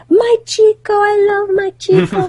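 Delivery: high-pitched mock crying wail; imitating tearful joy. Client crying over her Chico, imitated high tearful wail. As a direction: imitate a client wailing through laughter and tears. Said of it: "She's crying".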